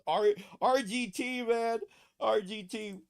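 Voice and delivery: loud, high, delighted cry of the name; excited shout. Real, not acted. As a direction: shout it out in gleeful disbelief. Excited reaction to RGT's reply.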